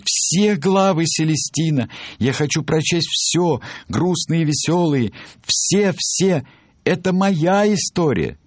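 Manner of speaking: very high-pitched, adamant character voice; insistent Reader. Acted. Reader refuses to let the author skip a chapter, high insistent voice. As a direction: push it up high and demand everything.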